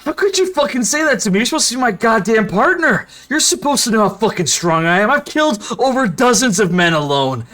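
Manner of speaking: angry ranting pirate. Acted. Pirate rages at his partner for mocking his strength, furious. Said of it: "anger, kind of fills his eyes".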